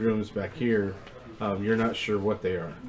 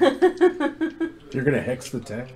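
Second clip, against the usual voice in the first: laughing; 4 semitones higher, 5 dB louder, much slower than usual. Real, not acted. Laughing disbelief at player's idea.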